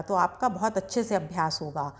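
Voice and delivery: usual speaking voice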